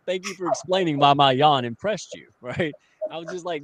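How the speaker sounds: normal talking voice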